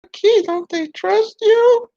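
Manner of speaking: a sly dummy needling; puppet voice. Acted. Puppet mocks him.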